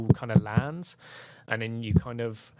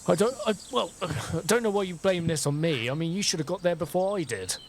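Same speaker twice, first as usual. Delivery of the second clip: higher-pitched, stammering self-defence; indignant and high-pitched; defensive protest; 8 semitones higher than usual. Acted. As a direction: throw the blame back, flustered. Acted quarrel over failed spell.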